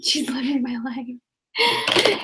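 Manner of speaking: slow, stretched mock envy; drawn-out dramatic echo. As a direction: savor each word, hamming it up. Echoes slowly, drawn out.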